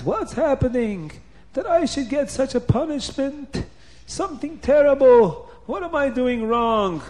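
high, loud, theatrical bewilderment; anguished lament. A narrator's reading. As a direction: wail the questions as if wronged. Paroi laments his punishment.

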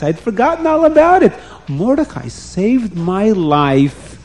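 king excitedly naming Mordechai; emphatic king. Acted. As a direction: set him straight with gusto. King corrects Haman emphatically.

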